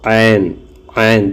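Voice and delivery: "ऐन" said from the middle of the throat, a squeezed ain; "ऐन" with a throaty ain; Urdu ain sound. Shown as a demonstration. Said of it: "तो इसकी आवाज़ निकलेगी ऐन".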